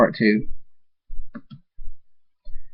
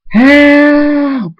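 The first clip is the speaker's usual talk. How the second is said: long, high, loud scream for help; screamed plea; 8 dB louder than usual. Acted. Trapped voice calling out for rescue, screaming. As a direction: scream it out and hold the note. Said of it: "Train's voice screams".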